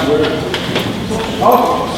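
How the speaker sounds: ordinary talk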